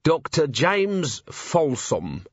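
slowly, each word weighed out; slow deliberate. Acted. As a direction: lay the name down slowly. Vimes answers slowly.

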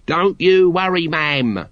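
volunteer it cheerfully in a drawn-out character voice. A narrator's reading. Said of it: "volunteered Nobby cheerfully".

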